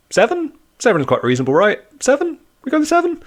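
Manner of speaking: hopeful, very high-pitched questioning; squeaky haggling, testing the offer; hammed-up tentative suggestion; Peter. Acting out Peter bargaining with Jesus.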